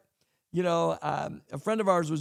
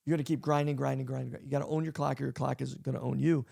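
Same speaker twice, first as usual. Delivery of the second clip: usual speaking voice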